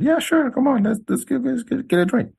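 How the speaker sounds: high, bright eager agreement, mock-keen; squeaky over-eager yes to a drink. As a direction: jump at the offer in a high voice. Eager reply to drink invite.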